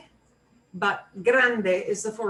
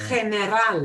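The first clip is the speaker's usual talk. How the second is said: general with AL punched, "kheh-neh-RAHL"; stress on AL; 4 dB louder than usual. Shown as a demonstration. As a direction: punch the last syllable of general. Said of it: "punch the al more".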